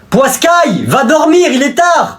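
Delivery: a hint of a high scolding parent; lightly voiced parent. Father telling his son to go to bed, lightly scolding. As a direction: shoo the kid off to bed.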